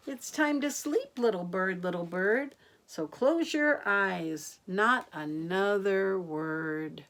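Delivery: sing-song rhyming read, lifting on each line; bedtime rhyme. A narrator's reading. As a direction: lilt the rhyme to little ones. Reads bedtime rhyme to children.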